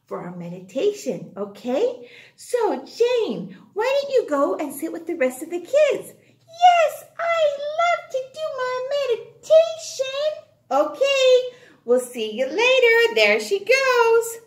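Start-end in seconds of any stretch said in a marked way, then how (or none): 6.1-10.3 put-on child voice. Teacher voicing child Jane.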